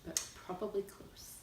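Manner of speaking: unvoiced hushed asides; whispering. Real, not acted. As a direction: breathe it out as a whisper.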